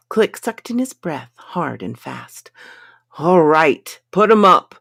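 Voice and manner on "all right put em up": harsh guard. A narrator's reading. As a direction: bark it like a hostile guard. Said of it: "a new harsh voice cried".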